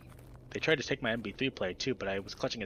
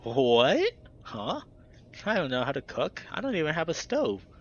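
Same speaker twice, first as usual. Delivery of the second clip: loud high startled what, then flustered higher lines; baffled; 5 semitones higher, 6 dB louder than usual. A narrator's reading. Heather confused by friends' cherry pie joke, startled. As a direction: caught off guard and confused.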